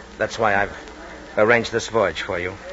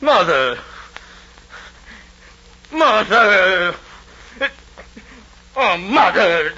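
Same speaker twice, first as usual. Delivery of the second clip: loud, high, rising calls; agitated calling; 10 semitones higher, 4 dB louder, much slower than usual. Acted. Nero calls to his mother returned alive, growing louder. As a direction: call her name louder each time.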